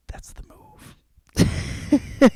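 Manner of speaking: slow, breathy conspiratorial whisper. Real, not acted. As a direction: lean in and whisper the verdict slowly. Whispered aside.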